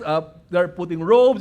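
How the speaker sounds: usual speaking voice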